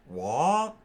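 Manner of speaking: a high, loud startled what; shocked exclamation. Acted.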